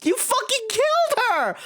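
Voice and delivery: very high-pitched outraged shriek; hysterical accusation. Acted. Streamer accusing Sholmes of killing her, hysterical shriek. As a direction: shriek it in melodramatic horror.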